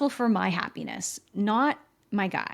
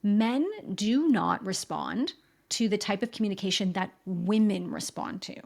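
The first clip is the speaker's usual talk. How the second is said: ordinary talk, nothing marked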